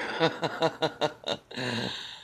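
quick laugh at own aside; real chuckle breaking character; laughing at the joke; amused laugh. Real, not acted.

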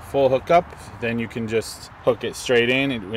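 ordinary delivery

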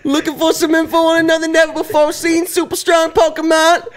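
put-on high squeaky girl voice; high girly voice. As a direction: chirp the exposition up high. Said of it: "who wants to voice this chick".

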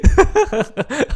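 high, loud laughter; real laugh. Real, not acted. Streamer joking about the glasses' reflection, laughing. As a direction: crack up at your own joke.

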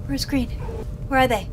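ordinary talk, nothing marked

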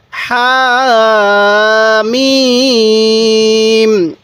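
very slow, with the vowels held long; elongated recitation. Shown as a demonstration. Teacher showing viewers how to elongate, held long. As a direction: sing out Hamim with the long madd. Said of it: "মনোযোগ সহকারে পড়বেন".

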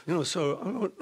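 usual speaking voice

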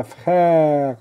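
"فخير" with a long open è. Shown as a demonstration. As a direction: breathe out through an open mouth on "فخير". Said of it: "مفتوح الفم وخارج النفس العلابرة".

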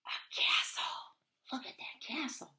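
hushed awed whisper. Real, not acted. Reader whispers in awe to the child. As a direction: breathe it out in wonder.

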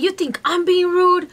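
high, affronted challenge. Retold or imitated. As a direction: imitate an offended doctor raising his pitch. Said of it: "he raised his voice at me".

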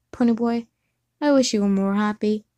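ordinary delivery

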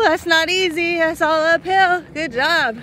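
high, excited praise; proud cheering. Real, not acted. Parent praising an uphill climb, excited.

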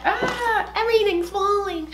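high squeal of dismay; flustered yelp. Real, not acted. Vlogger as her things topple, flustered and high-pitched. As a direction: break off in a high, flustered whine.

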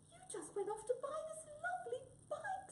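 very high squeaky voice; delighted high-pitched mouse; little mouse. Acted. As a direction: marvel in a tiny mouse squeak. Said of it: "said little Mouse".

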